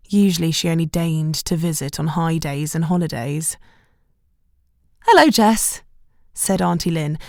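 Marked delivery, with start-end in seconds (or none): 5.1-5.8 pointedly cool greeting. A narrator's reading. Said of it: "deliberately not commenting".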